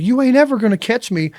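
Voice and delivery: high cocky taunt; mock kid taunt. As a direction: brat daring you to chase.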